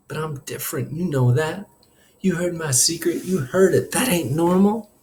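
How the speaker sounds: high, strained protest building in volume; upset kid pushing words out; voice straining. A narrator's reading. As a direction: plead to be understood, straining. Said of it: "my voice now straining, ripping".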